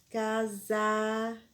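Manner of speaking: Casaco slowly, each syllable separate; slow dictation. Shown as a demonstration. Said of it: "Na segunda sílaba".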